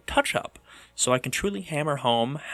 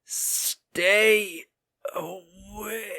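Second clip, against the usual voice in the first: higher, hammed-up anguished wail; mock despair; 6 semitones higher, much slower than usual. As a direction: act like the boredom is killing you. Melodramatic despair over boredom.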